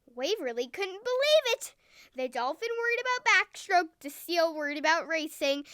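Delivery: ordinary delivery, nothing marked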